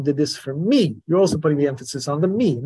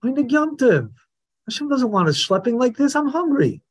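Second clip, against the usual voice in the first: higher, whiny complaint; mock whining son; 7 semitones higher than usual. A teacher mimics the wicked son complaining, mock whine. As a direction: whine it like a hungry brat. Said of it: "So this is what he's saying".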